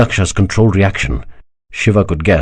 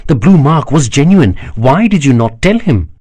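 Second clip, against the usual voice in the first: sharp, raised voice pressing the point; angry reproach; 7 semitones higher, 3 dB louder than usual. A narrator's reading. Kanakla presses the emperor, raised and reproachful. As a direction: accuse him of holding back, voice raised. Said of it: "glared Kanakla".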